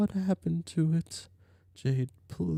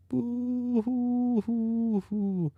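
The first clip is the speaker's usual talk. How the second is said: mock ghost sobbing; 6 semitones higher, much slower than usual. Jade wails over the refusal.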